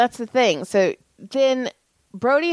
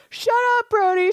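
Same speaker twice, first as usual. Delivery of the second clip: high mock snap at the little brother; mock annoyed brother. Mother imitating her older son telling his brother to shut up. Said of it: "Caleb's all".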